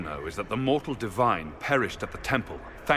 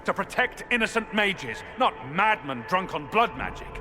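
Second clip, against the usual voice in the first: angry shout; 7 semitones higher than usual. Acted. Hawke shouting at the Warden in anger. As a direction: shout it back in fury.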